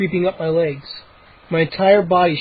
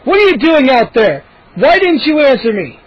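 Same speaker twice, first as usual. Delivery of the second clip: angry father; 7 semitones higher, 5 dB louder than usual. A narrator's reading.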